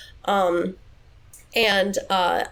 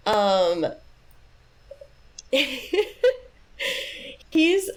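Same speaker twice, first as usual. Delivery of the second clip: ordinary delivery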